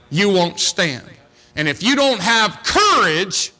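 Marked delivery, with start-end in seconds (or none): 1.6-3.5 preaching hard. Real, not acted. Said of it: "preacher pushing young people".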